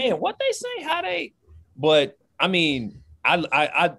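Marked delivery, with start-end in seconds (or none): 0.0-1.3 much higher, squeaky confused voice; baffled newcomer. Retold or imitated. Young player arriving in Louisiana, squeaky baffled voice. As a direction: play your young self lost in the accent.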